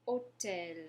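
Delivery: Hôtel with silent H and a closed o; Hôtel, no H sound, stress on tel; French hôtel. Shown as a demonstration. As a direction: model Hôtel for repeating. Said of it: "our next example is hôtel".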